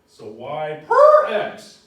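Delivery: slow, loud, high y per x; drawn-out emphasis. Shown as a demonstration. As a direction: stretch every word out. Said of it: "I can't say that enough".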